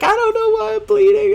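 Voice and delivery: mock panicked girlfriend; panicked girl. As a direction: squeak it out like she's panicking. Girl freaking out, voiced.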